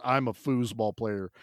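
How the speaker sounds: a hint of dejected delivery; mock deadpan. Armless hero muttering about himself, low and soft. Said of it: "and then he goes".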